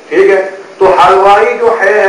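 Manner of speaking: usual speaking voice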